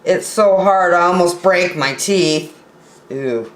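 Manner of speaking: ordinary delivery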